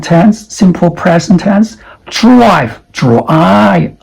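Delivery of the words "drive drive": drive, drive, clearly. Shown as a demonstration. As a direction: drive with the vowel aɪ. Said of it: "The vowel is A I".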